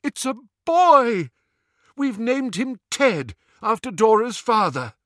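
very high, loud joyful announcement; crying aloud. A narrator's reading. New father announcing his son's birth, crying aloud. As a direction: proclaim the news to the whole room. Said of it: "then cried aloud".

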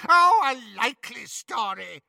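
high, scornful sneer; mocking witch. Bellatrix scorns Hermione's claim, high mocking sneer.

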